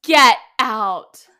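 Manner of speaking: loud, laughing astonishment; real disbelief. Real, not acted. Hearing the contestant is thirty-seven, loud disbelief. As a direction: burst out loudly in amused shock. Reacting to a surprising age.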